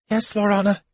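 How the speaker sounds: high, meek little voice; timid, piping assent; small, gulping reply. A narrator's reading. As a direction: answer obediently, high and small. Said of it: "Tass gulped".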